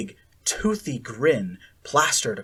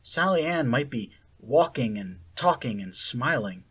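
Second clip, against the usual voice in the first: ordinary delivery, nothing marked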